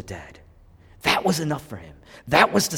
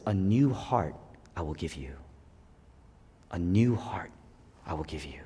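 tender promise; 8 semitones lower, 7 dB quieter than usual. Acted. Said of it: "God says".

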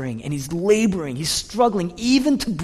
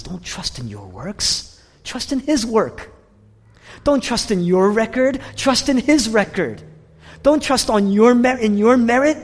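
preaching crescendo; 8 semitones higher, 6 dB louder than usual. Acted. Preaching at full force.

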